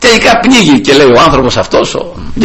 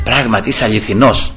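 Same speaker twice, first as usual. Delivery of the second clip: hushed prayer; 5 semitones lower, 6 dB quieter than usual. Retold or imitated. Private prayer to the Lord.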